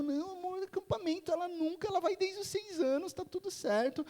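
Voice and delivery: high-pitched mock husbandly reassurance; dismissive reassurance. Husband brushing off wife's worry, retold.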